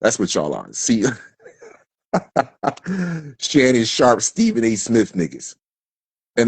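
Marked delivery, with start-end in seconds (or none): 2.1-3.3 short chuckling laugh. Real, not acted. Man mocking his critics as sports commentators, amused laugh. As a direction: laugh at your own jibe.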